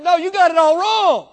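high, loud preacher's retort; shouted correction. Retold or imitated. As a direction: burst out in gleeful pulpit protest. Acted emphatic correction of guest.

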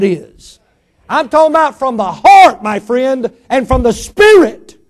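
fervent sermon climax; preaching at full force. Acted. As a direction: build it up for the congregation.

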